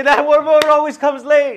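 high, slow, loud catty gossip voice; mocking the latecomer behind her back, high and drawn out. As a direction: sneer it like a church busybody. Interpreter mimics gossiping churchgoer.

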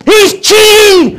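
high, loud shout at the referee; yelling at the TV. Retold or imitated. Fan shouting at the TV about cheating, loud yell. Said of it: "Cuando dicen".

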